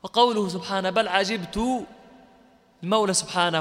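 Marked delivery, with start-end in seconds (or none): 0.9-1.9 "عجبت" recited as ʿajibtu; damma on the ta. Shown as a demonstration. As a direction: end "عجبت" on a rounded -tu. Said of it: "وبضم التاء".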